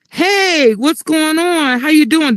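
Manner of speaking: high-pitched, over-friendly hello; eager greeting. Retold or imitated. Weed man running to the door to greet her, eager.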